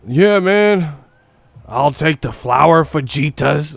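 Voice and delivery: high, loud, slow goofy ordering voice; mock clueless customer. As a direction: ham up a clueless guy ordering Mexican food. Mocking Fury ordering food.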